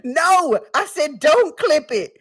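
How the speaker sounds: high, loud squealed protest; mock-stern protest. Real, not acted. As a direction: plead loudly, high-pitched, still giddy. Protesting at viewers clipping it.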